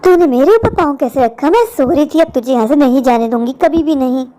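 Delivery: a low warning from a spirit; menacing spirit. Acted. Unseen spirit threatens the waiting woman.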